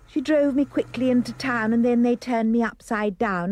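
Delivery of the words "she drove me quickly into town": ordinary delivery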